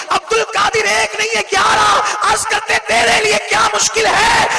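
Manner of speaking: high, loud, shouting sermon; full-force preaching. Acted. Preacher shouts the climax of the saint's plea to the crowd. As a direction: preach it at the top of your voice.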